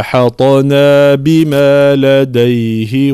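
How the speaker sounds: slow, drawn-out chanted recitation; Quran recitation. Shown as a demonstration. Teacher reciting the verse for students. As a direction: recite the verse slowly in tajweed style.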